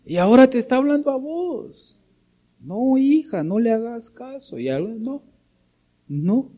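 a mother's voice pitched high, pleading with her daughter. Retold or imitated. Mother begging her daughter to ignore the boy, in a high pleading voice. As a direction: plead like a worried mom, up high.

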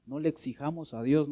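normal talking voice

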